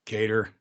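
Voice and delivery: "Cater" for Qatar, said KAY-ter; "Cater" with a long A, stressed first; alternative pronunciation. Shown as a demonstration. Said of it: "Everybody says it different".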